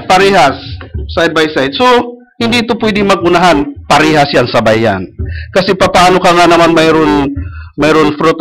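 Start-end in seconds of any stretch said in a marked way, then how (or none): none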